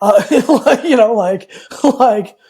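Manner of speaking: laughing it off; nervous laugh through the filler words; embarrassed laugh. Real, not acted. Embarrassed about crying on the call, laughing.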